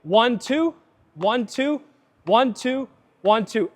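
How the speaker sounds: "one, two" in separated pairs, rising on "two"; steady cadence. Shown as a demonstration. Coach correcting the rhythm, "one, two" in measured pairs. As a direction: count "one, two" evenly, pausing after each pair. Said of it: "there's no cadence. So it's".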